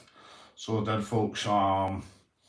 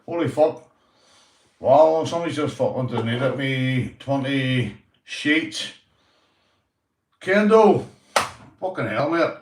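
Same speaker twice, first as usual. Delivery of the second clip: louder, higher surprised outburst; real excitement; 5 semitones higher, 8 dB louder than usual. Real, not acted. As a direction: blurt it out, thrilled by the gift. Reacts to surprise donation live.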